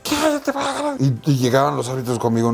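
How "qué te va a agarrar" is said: high mock friend voice. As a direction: hiss the warning up high. Friend warning him.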